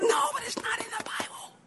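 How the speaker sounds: high, soft, hushed insistence; urgent whisper. Retold or imitated. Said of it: "I'm like".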